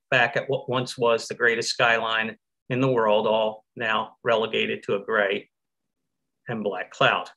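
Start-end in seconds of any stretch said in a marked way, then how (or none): none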